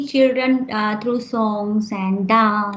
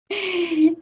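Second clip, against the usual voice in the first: brief higher-pitched laugh; short laugh; 5 semitones higher than usual. Real, not acted. Teacher amused after asking the student why, short laugh. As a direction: laugh lightly after your own question.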